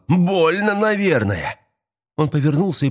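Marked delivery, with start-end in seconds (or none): 0.1-1.6 high mock sympathy; gleeful taunt. A narrator's reading. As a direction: jeer high at his pain. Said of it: "расхохотался Эстебан".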